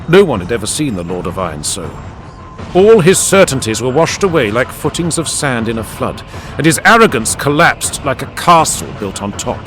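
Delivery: plain reading voice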